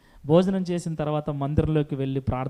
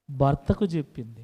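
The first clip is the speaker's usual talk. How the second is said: normal talking voice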